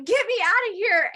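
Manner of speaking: high, loud retold outburst; mock desperate plea. Patient wanting out of painful treatment, hammed-up desperate cry.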